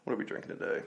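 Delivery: quick unvoiced question slipped in; whispered aside. Real, not acted. Host asking the guest about the drink in an unvoiced aside. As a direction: drop out of the intro to ask under the breath.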